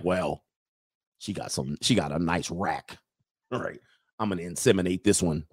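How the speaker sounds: lightly acted low, musing inner voice; mock rationalising. Imagined man rationalising past red flags.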